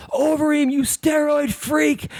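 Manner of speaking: high-pitched mock yell, drawn out; imitated drunken heckling. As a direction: heckle like a drunk fan, without full volume. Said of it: "he starts yelling".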